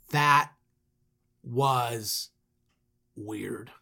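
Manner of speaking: long pauses between each word; halting, baffled verdict that sinks away; stunned deadpan. Acted. Brothers stunned after odd exit.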